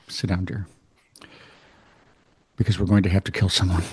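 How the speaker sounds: grim father voice. Retold or imitated. Doctor father voiced in joke.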